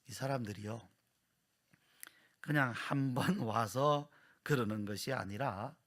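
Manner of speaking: normal talking voice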